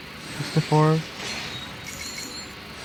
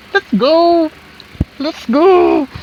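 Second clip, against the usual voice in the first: higher, louder let's go; excited cheer; 7 semitones higher, 6 dB louder, much slower than usual. Real, not acted. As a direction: cheer the win. Celebrating the pull. (masiglang sigaw)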